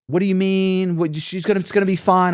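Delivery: high-pitched panicky pleading, retold; imitating her frantic denial; freaking out. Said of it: "Allison is freaking out".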